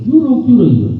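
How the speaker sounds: high, loud pleading wife; a wife wailing to her husband; wife's high voice. Acted. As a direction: jump up high as the crying wife.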